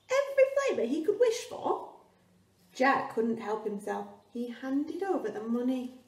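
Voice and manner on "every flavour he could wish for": high, fast, breathless wonder; a boy who can't believe his luck; excited wonder. Acted. Jack dazzled by the beans.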